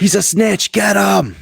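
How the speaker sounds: high, loud accusing yell; hammed-up, high-pitched call to pounce; mock alarmed shout. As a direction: play a bystander raising the alarm on a snitch. Mock mob turning on photographer.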